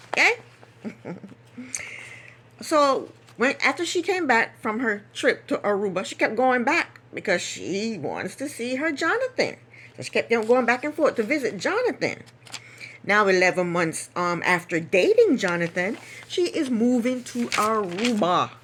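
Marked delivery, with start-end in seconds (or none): none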